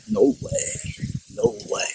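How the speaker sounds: high-pitched, hushed disbelief; a father who can't believe it, voice jumping high but dropping soft; stunned disbelief. Retold or imitated. Father hears son smokes.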